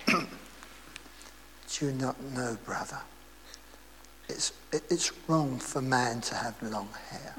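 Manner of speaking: low, soft, slow churchgoer's reproach; stern rebuke. Retold or imitated. A churchgoer quietly tells the long-haired preacher off, low and slow. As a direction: lean in and tell him off quietly.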